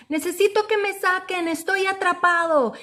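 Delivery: high, pleading cry from the pit; calling for help. Acted. Trapped boy in a hole calls up, pleading. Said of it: "empieza a pedir ayuda".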